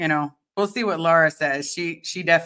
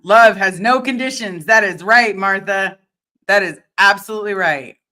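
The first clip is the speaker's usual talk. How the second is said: emphatic; 3 semitones higher, 7 dB louder than usual. Real, not acted. Emphatic agreement with comment.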